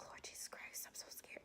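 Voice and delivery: scared whisper to Jesus; whispered prayer. Retold or imitated. Nervous recruit praying before the needle, terrified whisper. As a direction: breathe it out as a frightened prayer. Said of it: "I was like".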